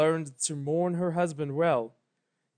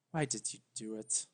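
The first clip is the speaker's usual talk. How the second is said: low, much softer accusing question; a quiet, hurt young princess; hushed grieving Twilight; 5 semitones lower, 11 dB quieter than usual. A narrator's reading. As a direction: ask it low and wounded.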